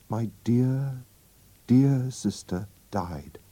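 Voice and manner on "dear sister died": ordinary delivery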